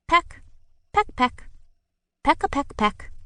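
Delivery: high quick pecks; pecking sounds. A narrator's reading. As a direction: tap out each peck.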